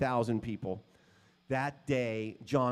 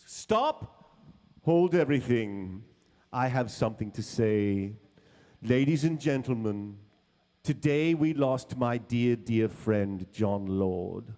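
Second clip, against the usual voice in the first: grand stage announcement; 6 dB louder than usual. Retold or imitated. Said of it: "David goes".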